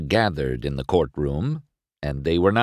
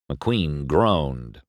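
usual speaking voice

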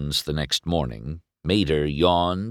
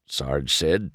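usual speaking voice

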